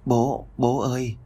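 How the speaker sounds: put-on slow, small child's voice, tired; weak little boy. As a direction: call out faintly like a sick little boy. Sick little boy calls father. (giọng bé trai ốm yếu gọi bố chậm rãi)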